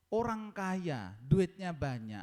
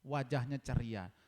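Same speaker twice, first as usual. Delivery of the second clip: ordinary delivery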